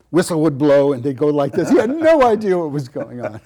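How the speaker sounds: loud and laughing. Real, not acted. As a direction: laugh through the words. Laughing while telling story.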